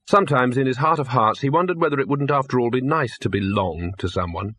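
ordinary talk, nothing marked

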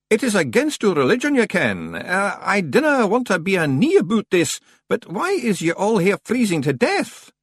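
high-pitched broad Scots voice; dodge, then question in high Scots; evasive Scots stranger. Acted. Stranger changing the subject with librarians, high broad Scots.

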